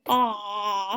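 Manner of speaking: "Ah" overdone, too big and crazy; a wrong, exaggerated "Ah". Shown as a demonstration. Teacher showing a too-big A, overdone. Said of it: "it's not gonna be like that".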